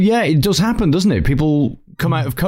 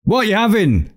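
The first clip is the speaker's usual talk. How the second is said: bad Scottish accent, pitched up; Billy Connolly; 6 semitones higher than usual. Shown as a demonstration. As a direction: put on a hammed-up Scottish accent at the bar. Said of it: "It tends to go a really bad Billy Connolly".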